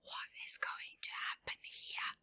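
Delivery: hushed, tense question; suspenseful whisper. Real, not acted.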